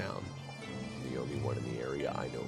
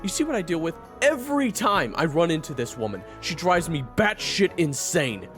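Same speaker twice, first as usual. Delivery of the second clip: exasperated rant; 13 semitones lower than usual. Acted. Fighter complains to companion about this woman, exasperated rant. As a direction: rant at full volume, dragging out the last words.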